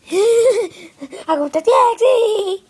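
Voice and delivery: slow, laughing triumphant yell; excited shout. Acted. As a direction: shout it out with glee. Triumphant toy outburst.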